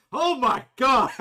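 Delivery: high, loud mock exclamation; muffled-through-door outcry. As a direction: cry out in exaggerated dismay. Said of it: "you can hear him like".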